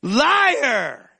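high, loud anguished cry; pained shout of the Spirit. Retold or imitated. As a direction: call out like a wounded conscience. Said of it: "Like the spirit was going".

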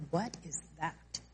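very soft, low, slow aside; acted private reaction, almost under the breath; hushed disbelief. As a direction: drop right down, as if questioning your own thought. Acted reaction to intrusive thought.

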